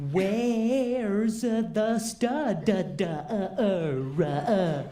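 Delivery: slow, stumbling mock read-out; sounding out a comment. Comedian voices an online comment, slowly stumbling through it. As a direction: drag it out, breaking into stray syllables. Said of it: "I prefer to get that comment online".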